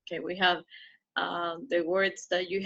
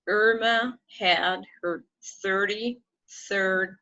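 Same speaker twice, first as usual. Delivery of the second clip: slow model reading; slower than usual. Shown as a demonstration. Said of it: "slow model reading".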